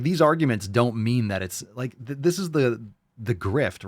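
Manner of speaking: ordinary delivery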